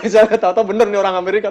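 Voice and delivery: fast, loud, laughing through the words; can barely get it out for laughing. Real, not acted. Laughing at imagined panic. (sambil tertawa, cepat dan keras)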